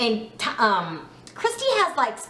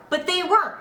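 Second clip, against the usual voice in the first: usual speaking voice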